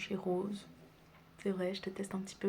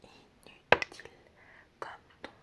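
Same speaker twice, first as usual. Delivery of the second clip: teasing whisper; slower than usual. Acted. Teasing about the ex.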